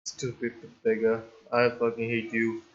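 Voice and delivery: quiet, low grumbling; low muttered curse. Real, not acted. Player cursing the game after the failure, low bitter mutter. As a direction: mutter the curse low under your breath.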